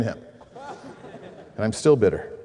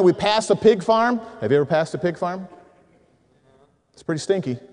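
usual speaking voice

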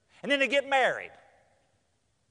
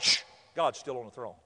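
low, settled reassurance after a shush; shush, then assure them in a low voice; calming hush; 9 semitones lower, 4 dB quieter than usual. Acted. Hushing the worrier.

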